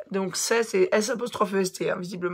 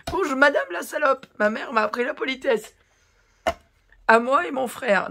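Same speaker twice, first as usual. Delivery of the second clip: higher, prissy mock-politeness; mock hater voice; 5 semitones higher, 4 dB louder than usual. Youtubeuse parodies the hater's polite insult, sneering. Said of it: "elle parle de sa maman".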